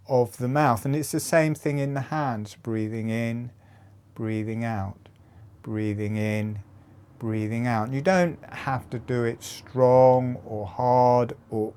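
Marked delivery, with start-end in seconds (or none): 2.6-7.9 soft, measured breathing guidance; softly pace each breath in and out; hushed cue with space between breaths. Shown as a demonstration. Paces breathing with hand movement.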